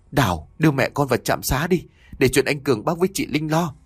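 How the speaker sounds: plain reading voice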